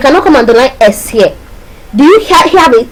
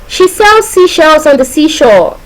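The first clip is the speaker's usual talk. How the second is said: slow, raised singsong recital; higher, measured example sentence; demonstration sentence; 5 semitones higher, slower than usual. Shown as a demonstration. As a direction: recite it slowly as one smooth tongue-twister line. Teacher demonstrates an alliteration example.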